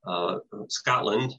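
ordinary talk, nothing marked

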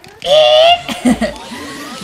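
high, loud spooky ghost cackle; ghost laugh. Pretend. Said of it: "I can do the, that ghost's voice just as well".

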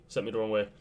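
normal talking voice

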